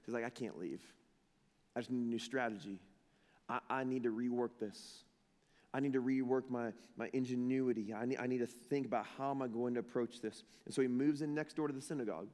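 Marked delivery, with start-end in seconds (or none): 0.2-10.4 low, soft thinking aloud; hushed, earnest self-talk; subdued resolve. Acted. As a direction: voice someone quietly talking himself into staying. Paul's sober rethinking, acted out.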